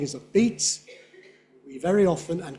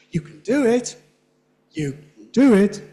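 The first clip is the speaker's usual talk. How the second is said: "do" punched higher and loud; emphatic stress; 5 semitones higher, 7 dB louder than usual. Shown as a demonstration. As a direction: hit every beat hard. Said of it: "it sounds emphatic".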